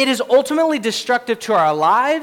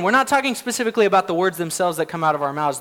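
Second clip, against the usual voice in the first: ordinary delivery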